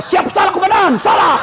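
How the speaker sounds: barked high loud military reply; hammed-up soldier yelling yes sir; policeman shouting to commander. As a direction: snap back like a scolded subordinate.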